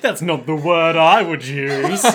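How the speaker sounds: loud, drawn-out comeback; Josuke. Acted. Josuke answering how he'd slip out, loud and slow. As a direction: declare it slowly and forcefully. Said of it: "Josuke's like".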